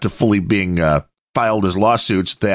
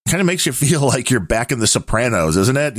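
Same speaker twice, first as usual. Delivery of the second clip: usual speaking voice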